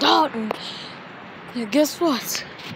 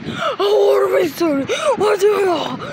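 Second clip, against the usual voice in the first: crying shout; 6 semitones higher than usual. Acted.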